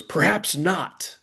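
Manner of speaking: high, drawn-out mock-triumphant declaration. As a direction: proclaim it slowly, imitating a triumphant flourish. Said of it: "then he triumphantly goes".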